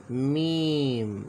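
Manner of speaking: मीम with the ee held very long; stretched letter name. Shown as a demonstration. Teacher to students, slowly stretched letter name मीम. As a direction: draw मीम out slowly for the class. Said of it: "teacher sounding out letter".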